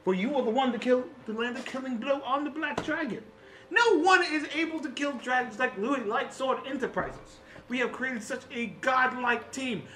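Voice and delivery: self-important hero addressing the team in grand phrases; boastful artificer. Acted. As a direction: brag theatrically about your part in the victory. Louis brags to his team.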